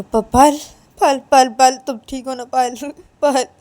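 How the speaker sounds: high, loud desperate calling of her name; frantic calling. Acted. Ananya calls out to injured Payal in terror, frantic. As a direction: try to wake her, terrified.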